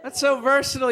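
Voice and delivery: usual speaking voice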